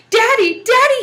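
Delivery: high, loud cry of Daddy; frightened holler. Acted. The little devil screams for his daddy as Jack approaches, loud and high. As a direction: yell for your father in a panic. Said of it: "he hollered".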